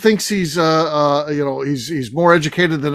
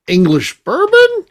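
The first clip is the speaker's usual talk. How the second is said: "bourbon" pitched up like a question; rising pitch; 12 semitones higher, 4 dB louder, slower than usual. Shown as a demonstration. Co-host showing how the host should have said it, rising inflection. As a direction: lift the voice at the end of "English bourbon". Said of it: "the pitch of the voice rising".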